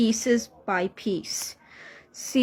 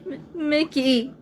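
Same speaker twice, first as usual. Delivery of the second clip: sobbing; 5 semitones higher than usual. Acted. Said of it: "starts sobbing".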